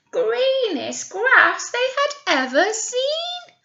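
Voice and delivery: lively storybook reading voice; animated picture-book narrator; story narration. Acted. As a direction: read it out to children with energy.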